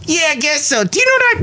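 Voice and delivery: very high squeaky echo of his partner; Bison Belly. Acted. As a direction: parrot it in falsetto, squealing at the end. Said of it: "this is Bison Belly".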